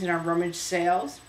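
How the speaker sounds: ordinary talk, nothing marked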